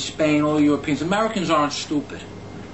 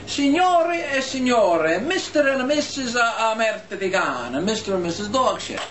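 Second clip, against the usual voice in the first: waiter announcing; 6 semitones higher than usual. Retold or imitated. Waiter announcing the insulted couple to the restaurant, mock-ceremonious. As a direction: present them grandly to the whole room. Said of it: "So he says to everybody in the restaurant".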